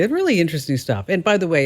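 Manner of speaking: usual speaking voice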